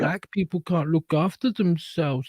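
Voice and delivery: talking down in mock concern for the helpless; patronizing. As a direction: coo condescendingly, imitating false sympathy. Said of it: "They're being patronizing to them".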